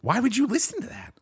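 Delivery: high, disbelieving question; incredulous. Real, not acted. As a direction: tease him in disbelief. Teasing disbelief.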